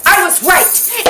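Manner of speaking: high, loud, slow grand pronouncement; grand Helen Hayes. Retold or imitated. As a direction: deliver it like a queen exiting. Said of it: "she went".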